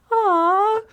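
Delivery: a high, squealing aww; gushing aww. Retold or imitated. Host reliving seeing her podcast ranking, high cooing aww. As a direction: coo it like something adorable just appeared. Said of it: "Like, I literally went".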